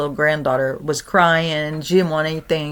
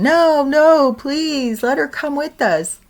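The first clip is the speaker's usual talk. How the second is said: put-on much higher pleading child; crying little girl; 9 semitones higher, slower than usual. Granddaughter pleading for ghost friend, high crying child. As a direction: beg through tears like a girl.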